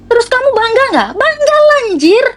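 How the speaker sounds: high excited outburst. Real, not acted. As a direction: shoot up high, all fired up. Excited rhetorical exclamation. (teriakan bangga yang bersemangat)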